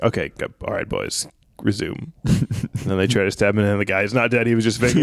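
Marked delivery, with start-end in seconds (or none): 0.0-2.1 low, quiet, weary go-ahead; deadpan resignation; Jesus resigned. Acted. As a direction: mutter it lower, slowing to a stop. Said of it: "Jesus is like".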